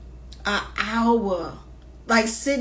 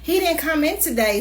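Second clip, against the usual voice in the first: receptionist quote; 7 semitones higher than usual. Retold or imitated. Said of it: "she said".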